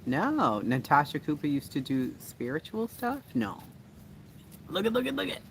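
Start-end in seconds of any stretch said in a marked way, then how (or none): 4.7-5.4 very high, repeated little squeal; excited squeal. Real, not acted. Excited squeal at something seen.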